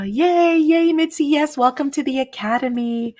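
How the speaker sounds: high, loud, cheery greeting; excited welcome. Real, not acted. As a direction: welcome them like a big celebration. Welcoming the member again.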